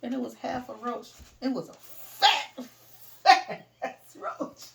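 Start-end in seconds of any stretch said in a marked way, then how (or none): 1.4-4.7 loud laughing telling; words breaking into laughter; laughing through it. Real, not acted. Telling friends about the roach, laughing loudly.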